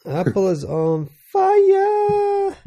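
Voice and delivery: high, slow, stretched-out exclamation; dramatic drawn-out echo. Pretend. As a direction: draw it out like a big announcement. Echoing a dramatic headline.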